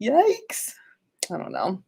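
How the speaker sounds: ordinary talk, nothing marked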